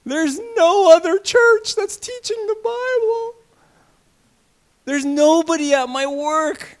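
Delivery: high, slow whining complaint; self-pitying believer. Retold or imitated. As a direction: play the lone martyr, drawn out. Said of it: "How many people here think that?".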